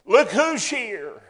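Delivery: slow, loud, drawn out; preached exclamation. Acted. Preacher announcing Jesus on the shore, slow and loud. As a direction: savour the reveal.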